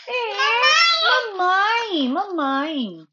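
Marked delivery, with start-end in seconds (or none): none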